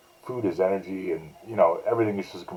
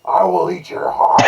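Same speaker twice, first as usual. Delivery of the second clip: higher, louder and slow mock monster threat; hammed-up monster; 11 semitones higher, 7 dB louder than usual. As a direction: growl it out like a silly playful beast. Mocking the dad's dinosaur game.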